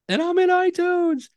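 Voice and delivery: high, loud joyful shriek; excited scream. Retold or imitated. Podcaster shrieking to her coach that her show is live, joyful scream. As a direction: scream it like a dream come true. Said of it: "And she goes".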